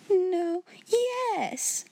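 very high, wavering answer; frightened. A narrator's reading. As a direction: falter from one answer to the other. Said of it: "began to turn pale".

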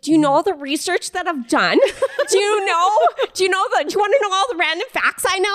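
high, laughing, rushed fact nerd; eager know-it-all. Retold or imitated. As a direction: gush your trivia at them.

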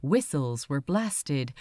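usual speaking voice

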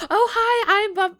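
high, loud imitation of a perky hello; excited greeting. Friend introduces herself to new seatmate, imitated excited greeting.

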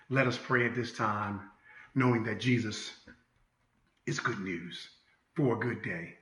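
soft, hushed prayer voice; gentle pastoral prayer; closing prayer. Acted. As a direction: pray over the congregation.

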